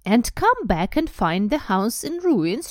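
drawn-out indignant snarl. A narrator's reading. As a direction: snarl it, high and loud. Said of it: "she snarled".

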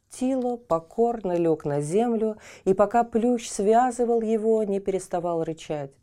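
ordinary delivery, nothing marked

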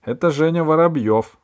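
put-on high, loud girlish introduction; child proudly announcing a friend. A narrator's reading. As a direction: present the guest eagerly and loudly. Girl announces guest to her mother.

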